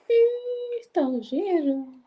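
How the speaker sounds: sudden high yelp of surprise; a startled little cry; surprised. Real, not acted.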